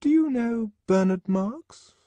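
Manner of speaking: very high, over-casual question; high, loud, put-on nonchalance; forced casualness. A narrator's reading. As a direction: ask it too airily to be innocent. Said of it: "tone whose excessive casualness was evidently forced".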